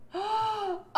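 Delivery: sharp, high intake of breath; excited gasp. Real, not acted. Co-host hears of Jordan Peele's new movie, excited gasp. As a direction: gasp in delight at news.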